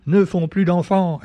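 lightly mock an old-fashioned alarmist commentator; Éric Zemmour impression. Vlogger mocking a pundit on immigration, lightly hammed-up old newsreel voice. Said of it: "il a un peu une voix comme dans les années quarante".

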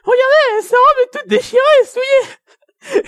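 very high, loud cartoon voice; high character voice. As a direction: squeal the line like a cartoon. High dubbing voice.

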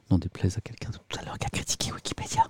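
a sly whispered jab; half-whispered teasing aside. Real, not acted. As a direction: drop to a whisper for a cheeky dig. Snarky aside about an earlier critic.

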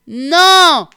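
one loud, high, forceful refusal; scolding shout. A narrator's reading. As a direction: cut him off with a sharp yell. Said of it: "gronda-t-elle". (gronder très fort, voix aiguë)

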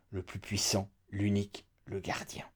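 trailing off into a whisper; fading to a whisper. A narrator's reading. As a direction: let it sink to a breath. Korag's pride fading into whisper.

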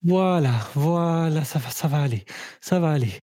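calm her down gently; soothing. Acted. Comforting after vomiting.

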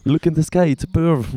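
high, playful English exclamation; mock onlooker's cry. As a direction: call out like spotting a superhero. Parody superhero cry in English. (exclamation parodique en anglais, aiguë)

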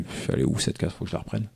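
whispering to himself, searching; a quiet annoyed whisper under the breath; whispered thought. Retold or imitated. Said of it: "je me dis". (pensée chuchotée pour soi)

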